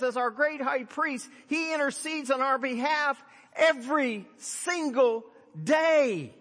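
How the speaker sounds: loud, high, driving sermon delivery; fiery pulpit exhortation, almost shouting; full-force preaching. Acted. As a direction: preach it to the back row at full power.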